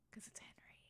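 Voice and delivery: breathy whispered aside; secretive whispered jab. Acted. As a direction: drop to a hushed whisper. Whispered aside about the name.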